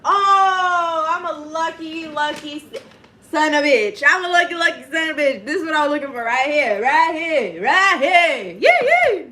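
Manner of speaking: high, loud burst of excited triumph. Real, not acted. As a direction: build to a whooping celebration of a lucky find.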